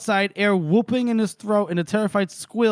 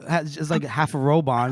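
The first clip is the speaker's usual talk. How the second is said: ordinary delivery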